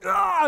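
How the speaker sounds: loud, high yelp of dismay; frustrated "ah!". Retold or imitated. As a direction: imitate a fooled player's exasperated cry. Retold duped opponent reacting.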